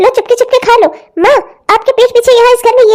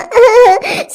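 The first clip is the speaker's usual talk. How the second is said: crying sob; choked crying sound. Acted. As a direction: break into a sob. Girl cries out.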